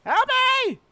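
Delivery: high panicked shout; scared horror game streamer. Real, not acted. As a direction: scream for help. Chased by enemy.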